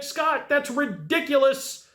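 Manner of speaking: high, slow mock disbelief; skeptical viewer. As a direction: drag it out like a doubting viewer. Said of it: "you may be like".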